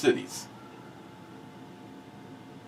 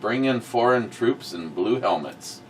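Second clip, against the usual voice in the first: ordinary delivery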